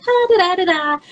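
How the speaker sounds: high, bouncy sing-song imitation; imitating an upbeat high voice. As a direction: chirp it up high like a happy hum. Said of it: "more upbeat and not scratchy and more like".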